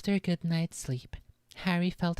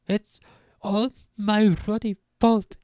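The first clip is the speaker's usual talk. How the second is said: louder, higher sobbing wail; 6 semitones higher, 8 dB louder than usual. A narrator's reading. Hagrid blaming himself to Harry, sobbing loud. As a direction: choke it out through tears. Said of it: "he sobbed".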